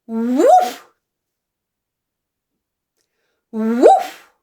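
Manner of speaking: loud, bouncy dog woof for babies; barking woof. Shown as a demonstration. Teacher barking woof for a baby to copy. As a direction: bark it out big and round like a puppy. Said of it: "Watch my mouth".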